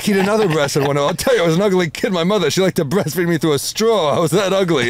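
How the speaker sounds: high, loud, fast Rodney voice; Rodney Dangerfield impression. Telling Rodney jokes to his widow on the phone, high and fast. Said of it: "I'm literally doing a Rodney impression".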